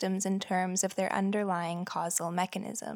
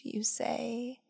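usual speaking voice

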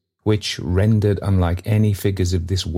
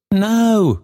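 high, louder eager denial; eager cry; 5 dB louder than usual. A narrator's reading. Trotty denies wronging the bells, crying eagerly. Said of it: "cried Trotty eagerly".

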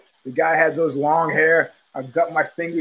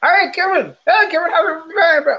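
higher, louder imitated call of "Hey, Kevin!"; mock holler; 13 semitones higher, 4 dB louder than usual. Fighter calling out to his opponent mid-fight, imitated holler. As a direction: holler it across the cage, half joking. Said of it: "Stewart's hollered at him".

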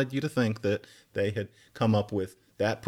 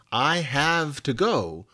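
slow, deliberate "I have to go"; slow demo; 3 semitones higher, much slower than usual. Shown as a demonstration. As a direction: spell out "I have to go" slowly. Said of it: "if you said".

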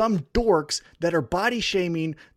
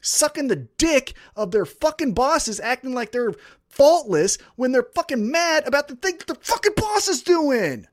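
raised pitch, real exasperated outburst; fed-up angry rant; 6 semitones higher than usual. Real, not acted. Fan sick of wrestlers defending bosses, fed-up angry rant. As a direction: explode at the start and keep the outrage high.

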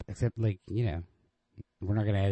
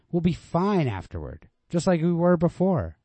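pleading reassurance; 10 semitones higher, 8 dB louder than usual. Acted.

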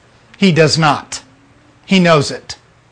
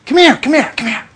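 higher coaxing call, lightly voiced; coaxing child; 8 semitones higher, faster than usual. Parent coaxing a child away from the dirt, lightly coaxing. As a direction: gently lure the child over. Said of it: "You say to the child".

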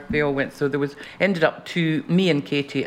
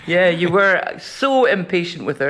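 ordinary delivery

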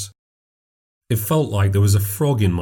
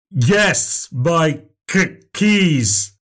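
stutter; 8 semitones higher, much slower than usual. Acted. Stranger stuttering, higher.

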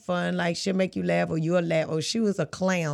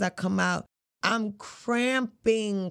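ordinary delivery

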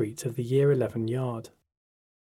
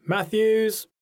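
Welsh teacher; 9 semitones higher, 4 dB louder than usual. A narrator's reading. Teacher calling the register.

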